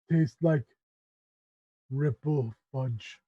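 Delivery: ogre voice. Acted. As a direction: savour it slowly as a dim ogre, with long pauses. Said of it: "He goes".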